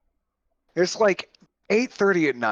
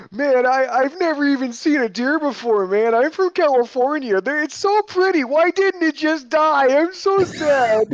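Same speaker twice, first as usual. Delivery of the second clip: mocking whiny airman; 10 semitones higher, 3 dB louder than usual. Retelling the airman who just hit a deer, high whiny mocking voice. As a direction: parody a distraught young Californian kid. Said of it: "venom-filled parody there".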